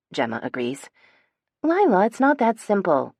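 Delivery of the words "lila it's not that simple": raised, higher and insistent pushback; defensive. A narrator's reading. Phoebe to Lila, defending herself, pitched up and louder. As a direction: defend yourself, a little stung. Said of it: "My voice is defensive".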